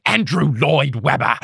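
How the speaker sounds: mock-British outburst of loathing; British accent. Retelling a TV character despising his sworn enemy, hammy British accent. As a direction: spit out the name in a put-on British accent. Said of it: "in his British accent".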